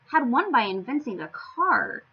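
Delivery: ordinary delivery, nothing marked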